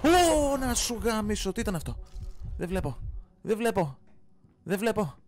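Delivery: loud high frightened cursing; terrified shouting at the screen; panicked yelling. Real, not acted. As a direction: yell in real panic, can't see. Jump scare, screen goes dark.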